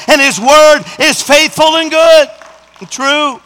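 loud, high, building preacher's cry; full-force preaching. Acted. Preacher lifting the church, loud and high. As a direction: preach it hard to the congregation.

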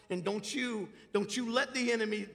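normal talking voice

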